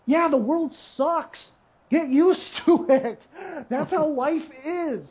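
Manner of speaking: high, slow, scornful put-down; harsh blunt retort. Retold or imitated. Replying to whiners, slow and scornful. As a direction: deliver it slow and dismissive. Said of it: "I'm like".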